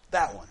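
firm hit on THAT, falling after; very emphatic. Shown as a demonstration. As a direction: stress THAT as if pointing. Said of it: "a very emphatic way to say it".

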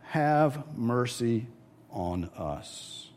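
slow, fading plea for mercy; humble plea. Retold or imitated. Blind men crying to Jesus, slow humble plea. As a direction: beg softly, fully humbled. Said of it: "these guys cry out".

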